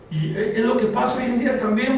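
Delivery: ordinary talk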